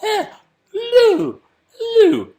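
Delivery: high, loud starstruck squeal; starstruck babbling. Pretend. Imagining meeting [name] at the Brits, a high, loud starstruck squeal. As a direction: lose all words in front of a star. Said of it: "make me go".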